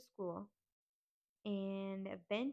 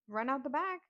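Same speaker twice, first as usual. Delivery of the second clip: louder, higher urgent command; aunt urging the kids to flee, louder; urgent order; 5 semitones higher, 6 dB louder than usual. Retold or imitated. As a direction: hurry them out the back door. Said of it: "my aunt's like".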